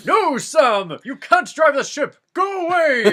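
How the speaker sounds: hammed-up wizard screeching at Sam; Gandalf shouting. Gandalf redoes his line, shrieking at Sam to leave.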